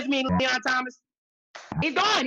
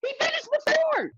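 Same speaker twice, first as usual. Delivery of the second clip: excited. Real, not acted.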